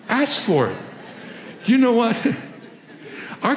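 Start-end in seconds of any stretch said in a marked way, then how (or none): 1.7-3.6 laughing through it, pitch up high; real amused laugh breaking into the words. Real, not acted. Preacher laughing to the congregation about kids, amused laugh.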